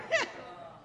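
high airy giggle; breathy laugh. Real, not acted. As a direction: laugh at your own punchline. Laughing at punchline.

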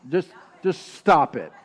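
ordinary talk, nothing marked

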